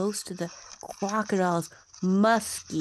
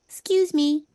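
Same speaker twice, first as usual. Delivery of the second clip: put-on high, louder small-child voice; a timid child asking politely; eager little elephant child; 8 semitones higher, 7 dB louder than usual. A narrator's reading.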